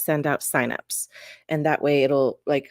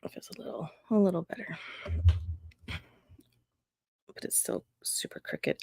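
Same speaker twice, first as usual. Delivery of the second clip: whispering to herself; 2 semitones higher, 10 dB quieter than usual. Real, not acted. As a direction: breathe it out under your breath as you adjust the shot. Muttering to self, fixing camera.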